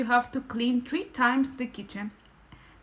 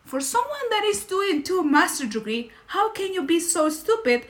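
high, loud mock scolding of a landlord; an exasperated landlord berating a tenant, higher and louder; retold yelling; 6 semitones higher, 4 dB louder than usual. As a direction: play the furious landlord telling her off. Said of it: "yelling me in English".

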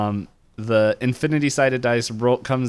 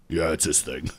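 ogre voice; 6 semitones lower, 4 dB quieter than usual. Retold or imitated. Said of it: "the ogre's like".

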